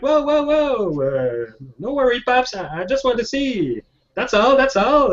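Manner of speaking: put-on high-pitched kid backing off; teenage kid. Curious teen soothing the old handyman, high and placating. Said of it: "So the kid is like".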